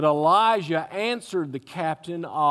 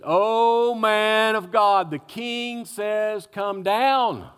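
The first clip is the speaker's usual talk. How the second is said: mocking; 5 semitones higher, 5 dB louder, much slower than usual. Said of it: "said the same thing".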